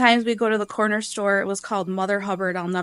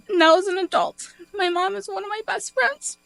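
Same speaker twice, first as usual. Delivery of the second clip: high, choked-up real emotion; tearful; 11 semitones higher than usual. Real, not acted. Daughter calling mom a best friend, tearful. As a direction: fight back tears as the pitch climbs. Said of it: "now I'm crying".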